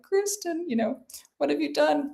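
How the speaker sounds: high, dismayed scolding of a friend; mock reproach. Readers scolding her over the scene.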